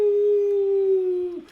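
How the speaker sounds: high whooping yell of delight; a loud, high hype yell; excited yell. Retold or imitated. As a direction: let out a big excited whoop. Retold yell at the long pitch.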